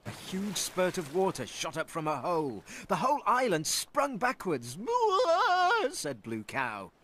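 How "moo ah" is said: high, loud startled moo. Acted. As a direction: moo out in alarm. Cow startled as island moves.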